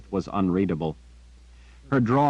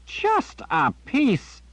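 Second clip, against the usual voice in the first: put-on very high, slow disbelieving old man; incredulous; much slower than usual. A narrator's reading. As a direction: echo it in disbelief. Zed incredulous.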